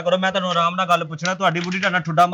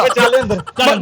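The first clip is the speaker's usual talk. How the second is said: loud, higher shout to go inside; shouted order; 5 semitones higher, 6 dB louder than usual. Acted. Husband yells at his wife to go back inside, loud and high. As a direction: yell her back inside.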